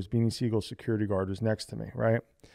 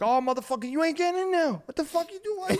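very high, mock furious yell; Sugar's angry squeak. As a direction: squeal it like an outraged friend. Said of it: "Sugar's like".

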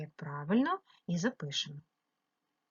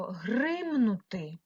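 гримнути, first syllable drawn out; stressed check word; 4 dB quieter, much slower than usual. Shown as a demonstration. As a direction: lean hard on ГРИМ in гримнути. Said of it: "підбираю споріднене слово".

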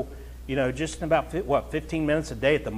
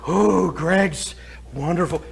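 mock admiration; slower than usual. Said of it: "I've had people say that".

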